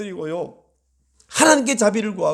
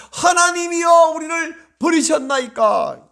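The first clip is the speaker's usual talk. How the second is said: crying out in prayer; 7 semitones higher, 4 dB louder than usual. Acted. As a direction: call out to heaven, stretching the words. Acts out Asaph's prayer to God.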